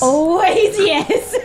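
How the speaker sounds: high, laughing sing-song echo. Real, not acted. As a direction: laugh the words out, drawn slow. GM laughing, echoing the player.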